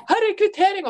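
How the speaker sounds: high hurried "her er kvittering"; mock panicked worker handing over receipt; stressed attendant. Retelling stressed attendant's line.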